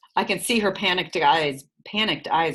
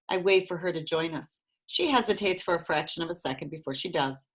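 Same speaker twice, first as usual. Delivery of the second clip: ordinary delivery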